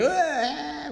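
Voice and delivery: high mock wail of a crying kid; imitated bawling. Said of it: "like bawling".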